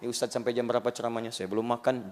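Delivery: low, soft, quick impatient grumble; restless listener. Retold or imitated. Hungry listener complains to the preacher, low and quick. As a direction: mutter it like a hungry congregant. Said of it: "Ya, mulai gelisah".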